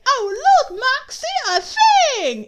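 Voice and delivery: very high, loud, over-excited squeal of words; mock an excitable cartoon wife, shouting high; hammed-up high-pitched shout; Millie impression. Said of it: "and she's like".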